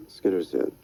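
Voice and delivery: usual speaking voice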